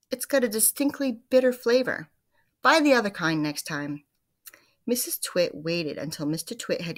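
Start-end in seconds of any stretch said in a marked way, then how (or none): 0.1-4.0 higher, louder grumbling demand; grumpy husband. Acted. Mister Twit grumbles about lunch to his wife, loud grumpy demand. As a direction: complain and order her about.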